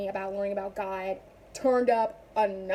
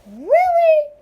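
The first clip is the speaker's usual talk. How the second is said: loud, very high incredulous outburst; indignant disbelief; 11 dB louder than usual. Acted. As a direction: shoot up high in exaggerated disbelief. Acted indignant reaction to critics.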